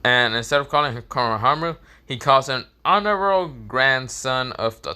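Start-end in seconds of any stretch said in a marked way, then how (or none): none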